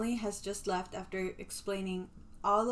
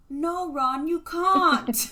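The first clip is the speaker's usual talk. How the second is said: high, louder mock British accent, Hermione-style; a hammed-up British schoolgirl scolding Ron; British Hermione impression; 8 semitones higher, 7 dB louder, much slower than usual. Friend imitates Hermione scolding Ron, mock British accent. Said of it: "Hermione's like".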